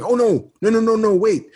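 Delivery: high, interrupting friend voice; Kevin impression. Friend changing the plan, imitated high. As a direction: cut in as a friend with a better idea. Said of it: "And he was like".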